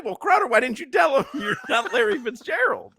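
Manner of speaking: high, laughing mock shout; mock accusing shout. Host imitating someone calling Crowder out, laughing high shout. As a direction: yell it half-laughing at the joke. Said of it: "someone will say".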